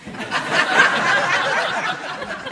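a high airless burst of laughter; high breathy laugh. Real, not acted. Teacher cracking up at her own children's story, high laugh. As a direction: let a silent, high laugh break out.

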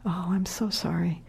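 a grieving stranger whispering sorry; whispered sympathy. Retold or imitated. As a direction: breathe the words like a hushed apology. Grieving woman's quiet condolence retold.

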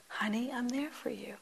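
soft, whispered, tender; tender reassurance. Retold or imitated. Teacher quoting Arena's daily reassurance, softly whispered. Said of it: "I can't say it in her exquisite accent".

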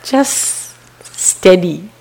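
very slow, drawn-out calm teacher's voice; quoting the teacher. Retold or imitated. Translator voicing the teacher to the retreat students, very slow and calm. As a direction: stretch each word like a patient meditation teacher. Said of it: "He said".

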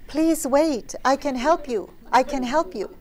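high, loud mock calling-out; calling after someone. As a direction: imitate an eager helper calling after a runaway. Acted calling after fleeing man.

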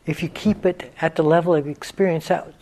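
unhurried meditation guidance with long pauses; guided meditation. Real, not acted. Teacher leading students into stillness, guided meditation voice. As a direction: guide them gently into stillness, leaving space between phrases.

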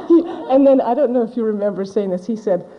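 real laughter breaking into the words; laughing through it. Real, not acted. Teacher recalling her interviewer's blunt advice, laughing. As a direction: giggle while telling the punchline.

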